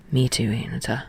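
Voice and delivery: quiet, low, almost shy suggestion; low tender Tsukishima. Acted.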